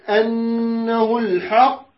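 الحق held slowly with a bounced final ق; strong qalqala. Shown as a demonstration. Teacher showing major qalqala on الحق. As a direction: stretch الحق and let its ق rebound. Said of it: "إذا كانت في النهاية تكون قلقلة كبرى".

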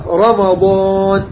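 RA in "رمضان" full and heavy, drawn out slowly. Shown as a demonstration. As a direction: model "رمضان" with a thick, emphatic R, held long. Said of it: "فخم". ("رمضان" براء مفخمة ممدودة)

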